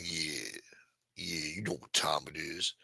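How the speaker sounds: low, soft, laid-back gangster voice; West Coast gangster. Pretend. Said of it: "West Coast gangster shit?".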